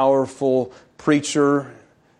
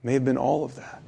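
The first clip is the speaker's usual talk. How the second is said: low, soft telling with long pauses; hushed sermon storytelling; 6 semitones lower, 7 dB quieter than usual. Real, not acted. Preacher tells how the disciples' eyes were opened, hushed storytelling. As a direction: let the revelation land quietly. Retells the moment of recognition.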